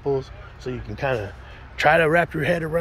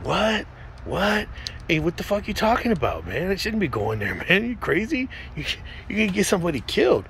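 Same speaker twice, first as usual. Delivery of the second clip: incredulous disbelief; 6 semitones higher than usual. Retold or imitated. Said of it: "I'm thinking".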